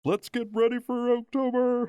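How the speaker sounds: high, loud ring announcer call; boxing announcer. Retold or imitated. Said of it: "the way that they introduce boxing fights".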